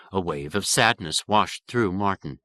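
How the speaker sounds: usual speaking voice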